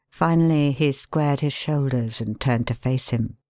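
ordinary delivery

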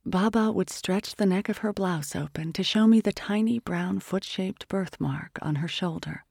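ordinary delivery

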